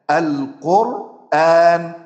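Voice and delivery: القرآن very slow and loud, hamza moved to the ra; pausal transfer reading. Shown as a demonstration. Teacher demonstrates stopping on القرآن. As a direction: lean on القرآن slowly, no glottal stop. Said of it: "مثال".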